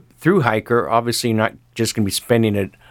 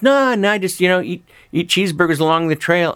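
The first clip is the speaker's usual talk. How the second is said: lightly voiced young hiker, higher pitch; casual hiker; 7 semitones higher than usual. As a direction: brush off the doubt in a higher voice. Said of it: "He goes".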